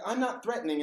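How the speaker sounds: high harmless voice, imitating an old self. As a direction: lift the voice to sound safe. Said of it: "have to kinda pitch my voice up".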